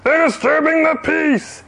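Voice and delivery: high-pitched, indignant yell; outraged official accusing troublemakers; shouted accusation. A narrator's reading. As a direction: holler the complaint to the police. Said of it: "shouted the fat man to the police".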